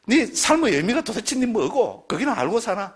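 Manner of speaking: high, slow, drawn-out needling question; scornful challenge. Acted. Challenging a friend on life's meaning, scornful. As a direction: press them with mock exasperation.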